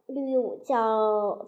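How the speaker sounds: plain reading voice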